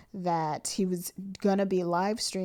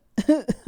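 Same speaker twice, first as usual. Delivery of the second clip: loud laugh; 7 semitones higher, 10 dB louder than usual. Real, not acted. Shocked at news, laughing.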